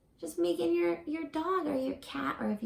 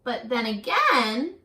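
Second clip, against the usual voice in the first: slow, weighty reading with long pauses, louder towards the close; storybook reading; 5 dB louder, slower than usual. A narrator's reading. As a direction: build to the last words, then let them fall away. Reading picture book aloud.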